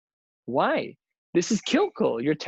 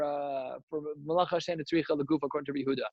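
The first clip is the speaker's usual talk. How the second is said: usual speaking voice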